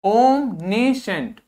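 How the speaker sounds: Omniscient drawn out very slowly, each syllable clear; slow model word. Shown as a demonstration. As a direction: stretch omniscient for the class. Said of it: "इसको देखिए". (Omniscient को धीरे-धीरे बोलें)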